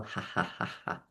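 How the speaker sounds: soft, breathy "ha ha"; whispered laugh. Shown as a demonstration. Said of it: "sending laughing tree roots".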